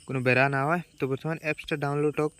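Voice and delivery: ordinary talk, nothing marked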